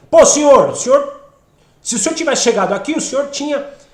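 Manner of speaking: loud, high, insistent complaint; indignant demand. Acted. Someone confronting the Lord for arriving late, indignantly demanding. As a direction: burst out accusing Him of failing you. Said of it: "é diferente da quem".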